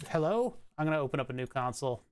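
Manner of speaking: normal talking voice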